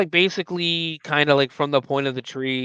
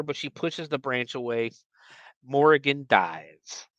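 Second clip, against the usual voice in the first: ordinary talk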